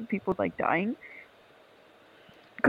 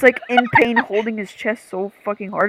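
ordinary talk

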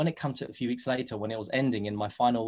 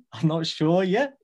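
laughing; 5 semitones higher, 5 dB louder, slower than usual. Real, not acted. Admits he has no plan.

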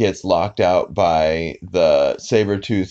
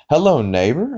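put-on lilting, higher-pitched children's host hello; Mister Rogers greeting; 6 semitones higher than usual. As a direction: lightly imitate a kids' TV host's sing-song greeting.

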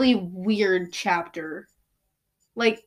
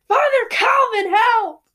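high, loud panicked shout; crying out for help; 12 semitones higher, 6 dB louder than usual. Acted. Meg calling her father and Calvin for help, a high frantic shout. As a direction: scream for rescue. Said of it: "Meg cried".

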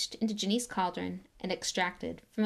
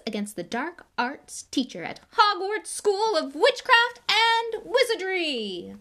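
rising high and loud, slowing at the climax; grand announcement; 6 semitones higher, 7 dB louder than usual. Acted. Lockhart announcing his Hogwarts post to the cheering crowd. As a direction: build to a triumphant reveal.

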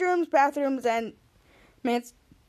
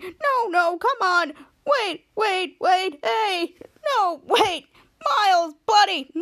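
higher-pitched retold begging; desperate pleading; 6 semitones higher, 4 dB louder, slower than usual. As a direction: call after someone who is walking out. Captain Man begs Miles to stay.

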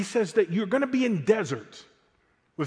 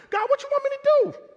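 much higher, louder squeaky panic; flustered prophet; 5 dB louder than usual. Retold or imitated. Preacher voicing the prophet protesting to God, high squeaky panic. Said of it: "Jeremiah's like".

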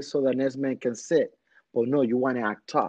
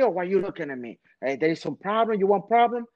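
higher-pitched challenge, lightly acted; mock confrontation; 6 semitones higher, faster than usual. Man in the gym challenging someone looking at him, mock confrontational. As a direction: imitate a man picking a fight.

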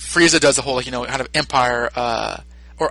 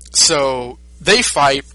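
slow, stretched telling of the fight; slowly, for comic length; drawn-out; much slower than usual. Retold or imitated. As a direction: draw it out to show it drags on.

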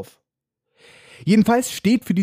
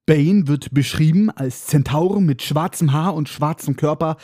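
ordinary talk, nothing marked

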